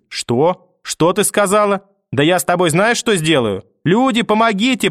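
rising to a high scream for help; mother's cry. Acted. Mother screams back at grandmother, building to a high cry. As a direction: cry out, outraged then pleading. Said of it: "мамин крик".

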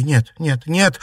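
high, loud, rising lament; distraught, escalating repetition; wailing denial. A narrator's reading. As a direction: wail it in disbelief. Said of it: "запричитала Наташа".

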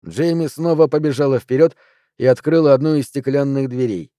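usual speaking voice

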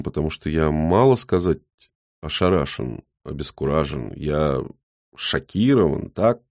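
plain reading voice

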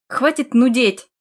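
slow, forceful angry bark. A narrator's reading. Husband silences nagging wife, barking. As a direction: cut her off, drawing the words out hard. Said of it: "рявкнул Хиян".

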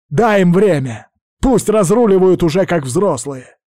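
high, loud angry shouting. A narrator's reading. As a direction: yell him down to make him stop. Azul shouts at Arshat in quarrel.

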